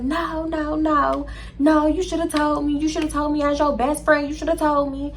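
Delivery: high-pitched imitation of a friend protesting. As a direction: act out her insistent protest, high. Friend protests in retold call.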